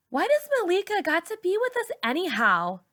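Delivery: put-on very high whiny teen girl; Raina's whine. A narrator's reading. Raina complaining.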